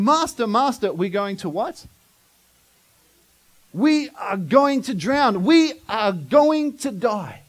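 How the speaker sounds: loud, drawn-out, frightened pleading; panicked plea. Retold or imitated. Disciples waking Jesus in the storm, loud and panicked. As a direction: beg for rescue as the boat fills. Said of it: "they start to panic".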